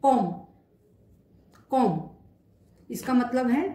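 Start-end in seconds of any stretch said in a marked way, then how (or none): 0.0-2.0 कॉम said with a short open o; French "comme", कॉम. Shown as a demonstration. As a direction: say कॉम clearly, kom. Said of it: "एक और example देखते हैं।".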